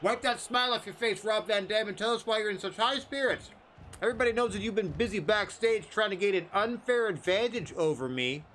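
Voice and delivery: high, taunting heel promo voice; mocking wrestler trash talk. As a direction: sneer it like a heel cutting a promo. Wrestler promo voice for Kevin Owens.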